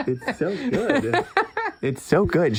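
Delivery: laughing praise. Real, not acted. Host delighted by co-host's accent, laughing. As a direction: draw it out through real laughter.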